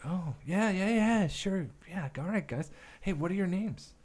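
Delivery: high, quick, distracted yeahs; brushing them off. Busy director brushing off two extras, high and hurried. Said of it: "And he's like".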